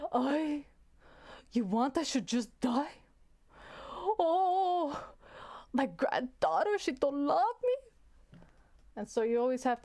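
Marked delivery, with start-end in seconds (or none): 0.1-8.4 put-on high, slow, wailing old grandma voice; put-upon grandmother moaning to her granddaughter; guilt-tripping grandma. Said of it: "it's my grandma voice or my auntie's voice".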